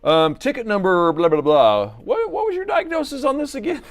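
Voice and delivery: 1 mock repair caller. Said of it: "and be like".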